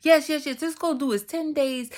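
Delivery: imitating an eager friend, high and loud; insistent urging. Friend talking her into the fast, insistent. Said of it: "she just kept saying to me".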